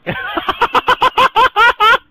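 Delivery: high, loud cackling laugh; loud burst of laughter. Real, not acted. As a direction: crack up at the punchline. Reaction to the punchline.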